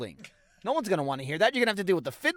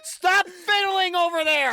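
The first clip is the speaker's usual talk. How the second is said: slow, louder playful scold; mock scolding shout; 9 semitones higher, 4 dB louder, slower than usual. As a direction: call it out like a fed-up parent. Scolding the fidgety co-host.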